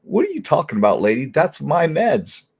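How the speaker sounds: patient protesting to thief nurse, mock outrage; indignant. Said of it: "I'm like".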